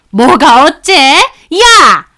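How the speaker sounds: loud, high shriek of rage; enraged scream. Acted. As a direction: yell after her as she hangs up. Mother-in-law screams at end. (전화 끊기 전 시어머니의 고함)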